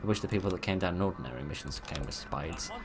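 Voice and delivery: usual speaking voice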